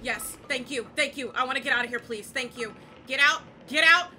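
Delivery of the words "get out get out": loud, high repeated yell; frantic shouting. Real, not acted. Player escaping, yelling at partner to get out. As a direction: keep yelling get out while running. Fleeing again.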